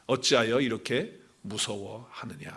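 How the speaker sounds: quiet, half-whispered reproach; gentle reproach. Acted. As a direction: ask softly and gravely. Jesus gently questions the disciples.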